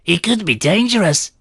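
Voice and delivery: put-on high, nervous young boy voice; scared boy. A narrator's reading. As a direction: pipe up like a frightened boy. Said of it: "looking scared".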